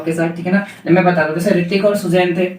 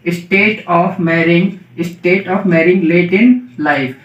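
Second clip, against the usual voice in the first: slow dictation; much slower than usual. Shown as a demonstration. Teacher dictating a definition.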